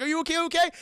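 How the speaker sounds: high-pitched imitated worried check; urgent concern. Dallas goes over after the scare, imitated urgent concern. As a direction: act out an alarmed check-in.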